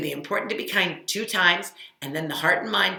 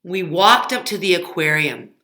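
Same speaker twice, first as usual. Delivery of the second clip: louder, lively read-aloud voice; reading to children; 10 dB louder than usual. Acted. Teacher reads the aquarium story to kids, lively. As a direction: read it out to little listeners.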